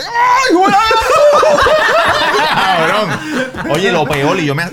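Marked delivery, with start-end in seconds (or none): 0.0-3.7 a yelp that breaks into loud laughter; laughing yell. Retold or imitated. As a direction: shout it high, then laugh it off. Reliving sudden ear pain. (grito entre carcajadas)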